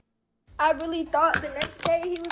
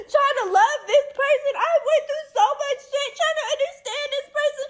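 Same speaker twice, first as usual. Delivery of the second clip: much higher, tearful, half-laughing sobbing; crying vow; 6 semitones higher than usual. Real, not acted. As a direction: swear it through sobs and bitter laughs. Crying about leaving abuser.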